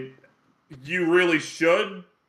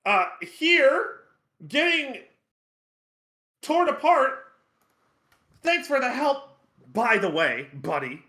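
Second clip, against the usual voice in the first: high, whiny sarcastic voice; sarcastic imp; 7 semitones higher, slower than usual. Acted. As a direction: snipe back like a cranky imp. Chard sarcastic to companion.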